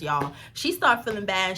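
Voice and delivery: ordinary delivery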